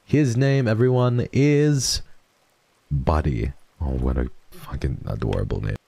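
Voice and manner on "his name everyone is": big reveal. Real, not acted. Building up the dog's name.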